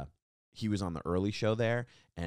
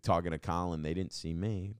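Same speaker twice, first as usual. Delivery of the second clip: ordinary delivery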